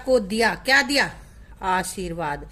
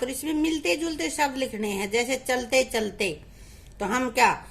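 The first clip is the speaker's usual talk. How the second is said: normal talking voice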